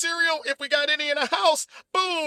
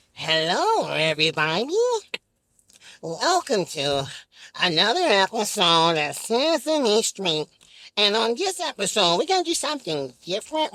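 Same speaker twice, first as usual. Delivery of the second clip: Big Bird voice; 7 semitones lower than usual. Pretend. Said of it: "Big Bird's like".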